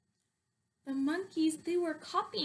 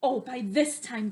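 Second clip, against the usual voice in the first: plain reading voice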